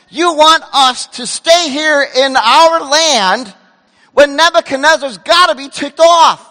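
loud, incredulous crowd shouting at the prophet; rebellious crowd yelling at Jeremiah, loud and scornful; mocking outcry. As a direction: shout it like the people telling Jeremiah he's crazy. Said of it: "The people said".

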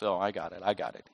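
low, soft mock exasperation. Brother in the sibling fight, low acted exasperation. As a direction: drop it low, as if fed up. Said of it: "in kind of exasperation".

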